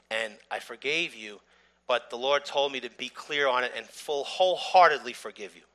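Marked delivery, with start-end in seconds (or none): none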